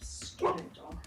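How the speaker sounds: whispered, irritated aside; muttered aside. Acted. Woman muttering to herself about her dog, under her breath. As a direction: say it under your breath, annoyed.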